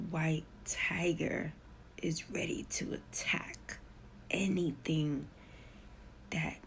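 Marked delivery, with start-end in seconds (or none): none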